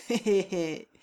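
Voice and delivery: lightly voiced snicker; a mocking little laugh; sneering giggle. A narrator's reading. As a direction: Katiche sniggering. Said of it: "ricana Katiche".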